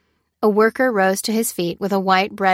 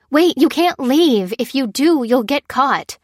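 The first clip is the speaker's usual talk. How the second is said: high, insistent plea to stop; urgent warning; 6 semitones higher, 4 dB louder than usual. A narrator's reading. Warning a man who is about to leave, urgent. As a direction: call out a slower, higher-pitched warning.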